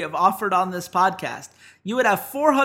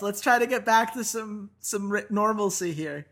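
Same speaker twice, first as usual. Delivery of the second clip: ordinary talk, nothing marked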